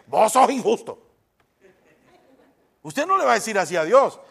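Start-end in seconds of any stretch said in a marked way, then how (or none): 0.1-1.1 mock accusing God. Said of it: "no se atreve a decirle directamente".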